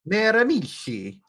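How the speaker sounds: Miramichi with a rolled Italian lilt, joking; mock Italian Miramichi. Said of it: "Say it with me".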